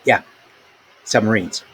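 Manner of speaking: usual speaking voice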